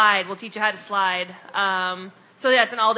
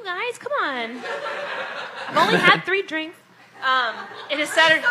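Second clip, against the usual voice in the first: laughing through the words, higher; 6 semitones higher than usual. Real, not acted. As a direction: giggle while correcting yourself. Flubs the date, laughs.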